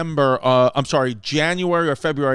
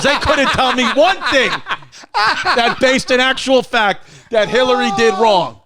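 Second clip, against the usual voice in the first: gleeful laughing quote; 8 semitones higher, 6 dB louder than usual. Retold or imitated. Quoting director gleefully, laughing.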